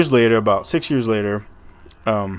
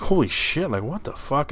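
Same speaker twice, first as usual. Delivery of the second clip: retold shock; 4 semitones higher, 4 dB quieter than usual. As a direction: imitate a stunned driver with swooping pitch. Driving aunt sees the creature.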